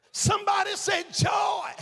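high, loud call to the congregation; rally them to answer back; shouted call and response. Acted. Preacher calls for a response.

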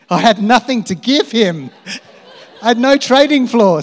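high, loud, laughing through embarrassment. Retold or imitated. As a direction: laugh it out, mortified.

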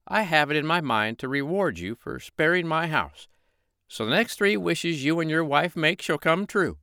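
the fairy promising three wishes. Acted. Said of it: "said the fairy".

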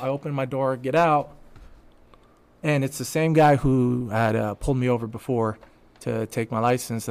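usual speaking voice

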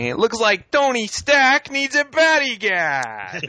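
loud, high, hammed-up Brooklyn accent; Steven Bloom Brooklyn voice. Villain taunting about Tony Stark, loud Brooklyn voice. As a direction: belt it out in a broad Brooklyn accent. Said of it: "I gotta do the Brooklyn, like, Steven Bloom, uh, voice".

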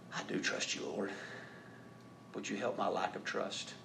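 soft, near-whispered prayer; quiet honest prayer; hushed prayer. Acted. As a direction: murmur it to the Lord.

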